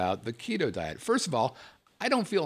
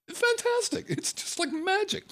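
much higher, giddy praise; mock rapture. Praising cauliflower rice to listeners, high giddy delight. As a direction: sell it like a magic trick.

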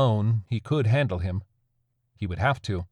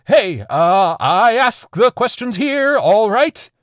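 high, loud, slow bluster; trying to sound tough; 12 semitones higher, 9 dB louder, slower than usual. A narrator's reading. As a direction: fake authority, overreaching.

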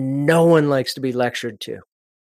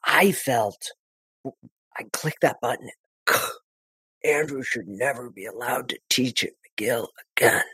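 much softer, lower and crushed; reliving devastation; 4 semitones lower, 7 dB quieter than usual. Retold or imitated. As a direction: let the old hurt come back into the voice. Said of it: "you hear that in the voice".

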